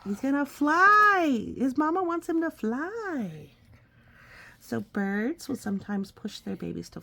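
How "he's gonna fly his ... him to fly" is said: excited exclamation. Real, not acted. Parent telling the child the bat will fly, excited. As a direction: announce it with big excitement.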